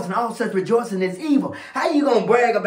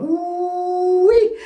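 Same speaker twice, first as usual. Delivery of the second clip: long, high-pitched "ooh" held, then a short "wee"; drawn-out falsetto-high ooh-wee; high preacher's whoop; 13 semitones higher, 5 dB louder than usual. Real, not acted. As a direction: let out a sustained high whoop. Preacher whoops between points.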